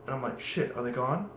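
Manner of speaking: very soft cautious murmur; hushed wariness. Retold or imitated. Kid checking if the aliens left, mock hushed wariness. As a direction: say it as if afraid of being heard, lightly acted.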